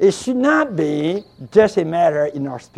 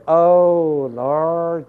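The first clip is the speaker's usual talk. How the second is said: sweet, intimate; 4 semitones lower, slower than usual. Acted. Preacher showing an intimate call to the Lord, tender. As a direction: call on the Lord gently, drawing it out. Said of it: "how sweet, how intimate".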